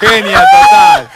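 high, slow, stretched exclamation of admiration; playful overdone admiration; drawn-out praise. Real, not acted. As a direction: sing out the praise, long and high. Praising camionero's ex-wife.

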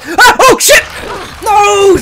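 shouting at a lunging monster; real shout of fright. Real, not acted. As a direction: yell in sudden panic. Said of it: "I'm sorry for shouting".

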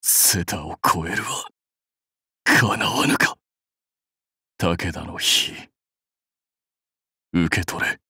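low, failing, whispery voice; mortally wounded. Acted. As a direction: force out last words on fading breath. Defeated warlord falling. (瀕死の息も絶え絶えな声)

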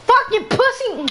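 angry shout; raging gamer. Real, not acted. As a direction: snap at an opponent in rage.

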